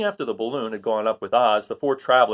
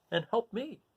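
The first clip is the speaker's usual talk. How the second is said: soft, high, forlorn; a child's sad murmur; near tears; 11 semitones higher, 8 dB quieter, slower than usual. Acted. Dorothy despairing quietly.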